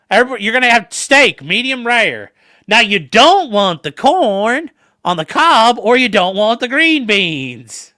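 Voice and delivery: loud, high, stretched-out waitress voice; old lady waitress. Retold or imitated. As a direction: lay down the menu like a bossy old waitress. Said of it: "old lady who's the waitress, she's like".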